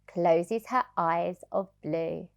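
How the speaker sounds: ordinary delivery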